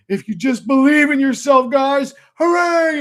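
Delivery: high, loud, hammed-up guru cheering a crowd; mock motivational speaker. Mocking a business speaker rallying new owners, in a high, loud, cheesy shout. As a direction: ham it up like a cheesy stage guru yelling hooray. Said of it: "they sit down, they go".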